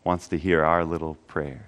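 soft, low prayer voice, slowing near the end; quiet prayer. Acted. Pastor prays softly before the congregation, quiet prayer voice. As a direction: pray quietly and earnestly to God.

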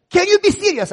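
high, loud outraged question; exasperated shout. Retold or imitated. Official yelling at joking young pastor. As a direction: yell it, fed up.

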